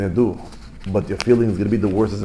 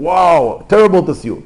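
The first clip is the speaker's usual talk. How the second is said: mock dismay; 6 semitones higher, 6 dB louder than usual. Onlooker shocked at an injured man.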